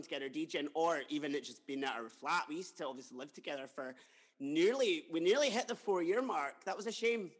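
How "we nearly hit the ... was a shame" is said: ordinary talk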